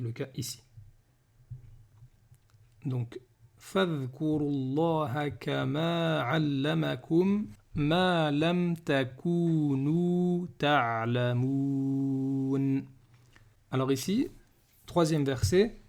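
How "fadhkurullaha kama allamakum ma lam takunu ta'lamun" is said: slow, sustained verse reading; Quran recitation. Shown as a demonstration. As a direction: recite in long lines, holding the final syllable.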